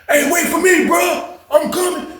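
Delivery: high yelled call after a friend; high shout, hurrying to catch up; calling out to wait. Retold or imitated. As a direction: holler it high, chasing him down the hall.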